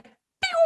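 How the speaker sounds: high whistled "pew" whoosh; zooming noise. Retold or imitated. Swimmer showing how she flew, high zoom noise. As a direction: imitate shooting off at speed. Imitates zooming through water.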